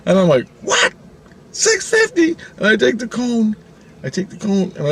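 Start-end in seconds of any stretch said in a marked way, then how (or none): 0.6-2.4 very high, disbelieving squawk; mock-outraged customer; incredulous. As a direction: shoot the pitch way up in shock at the price. Shocked at the price, retold.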